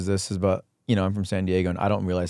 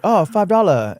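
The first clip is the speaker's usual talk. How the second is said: mock Chinese accent; 8 semitones higher, 4 dB louder than usual. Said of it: "I was like".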